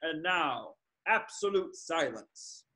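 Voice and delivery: low, soft suspense; hushed announcer. Acted. Announcer before the dive, hushed and suspenseful. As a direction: hush the crowd, low and soft.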